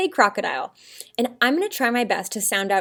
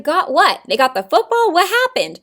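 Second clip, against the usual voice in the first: louder, higher, mock-confused listener; baffled questioning; 7 semitones higher, 7 dB louder than usual. Teacher acting out confused readers to her class, baffled questioning. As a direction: demand to know what you missed. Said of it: "You're like".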